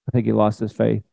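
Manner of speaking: normal talking voice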